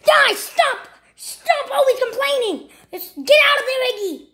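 high, agitated shouting; exasperated scolding. Acted. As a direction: yell at them to cut it out. Scolding the quarrelling characters.

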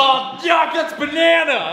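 disgusted surprise. Real, not acted. Tasting banana surprise.

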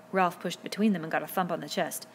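ordinary delivery, nothing marked

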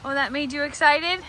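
ordinary talk

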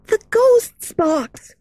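put-on high, slow, trembling girl voice; frightened hush. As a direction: say it with dread. Meg, fearful.

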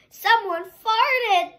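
slow, high, sing-song shout; group shout. Acted. Family shouting to the police officer, slow high call. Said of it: "Everyone shouted".

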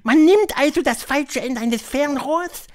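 high, loud witch voice; high-pitched, theatrical witch leader; Grand High Witch. A narrator's reading. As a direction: lecture the coven in a high, imperious voice.

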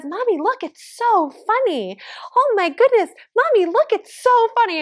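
high-pitched imitation of a delighted little kid; amused toddler. Toddler telling mommy it's so funny, imitated high and excited. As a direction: play a small child tickled by everything. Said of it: "he literally says".

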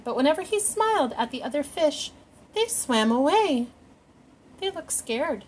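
lively picture-book read-aloud; animated storytime narration; reading to children. A narrator's reading. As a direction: read it like storytime for little ones.

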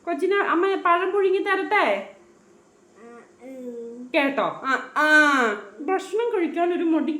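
put-on high, lilting questions to a baby; baby talk. Mother offering her baby steamed banana, high sing-song baby talk.

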